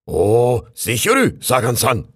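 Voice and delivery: raccoon imitating the locals, high and loud; mock Japanese accent. Raccoon mimicking how the locals talk, mock Japanese accent. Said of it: "die reden hier bestimmt alle so".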